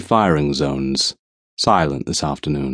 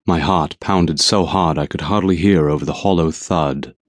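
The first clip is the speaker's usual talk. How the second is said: ordinary delivery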